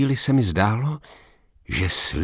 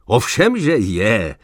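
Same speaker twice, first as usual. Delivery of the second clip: superior certainty; 3 semitones higher, 5 dB louder, slower than usual. A narrator's reading. Old man assures the doubter, lofty and loud. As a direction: brush the doubt aside. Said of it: "zasmál se povýšeně".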